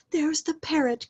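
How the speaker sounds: high delighted cry; excited cry. A narrator's reading. Scrooge spots the parrot from his boyhood, excited high cry. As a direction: point it out with glee. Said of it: "cried Scrooge".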